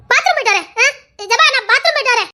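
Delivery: announced excuse. Acted. Making an excuse to slip away, announced in a high voice. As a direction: call out an excuse to leave.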